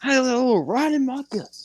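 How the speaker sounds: high, fast squeaky parody of the catchphrase; mock Cameron Grimes. Cohost mocking a wrestler's catchphrase, high and fast. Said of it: "Didn't Cameron Grimes say that?".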